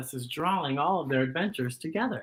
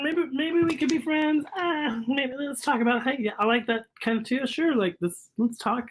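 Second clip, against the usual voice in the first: shy new friends; 6 semitones higher than usual. Acted. Voicing shy characters.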